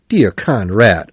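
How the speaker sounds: ordinary delivery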